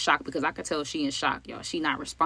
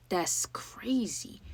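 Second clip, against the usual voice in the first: slow, hushed disbelief; stunned whisper; 3 semitones higher, 8 dB quieter, much slower than usual. Real, not acted. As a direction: whisper it slowly, drawn out in disbelief. Stunned reaction to the grim news.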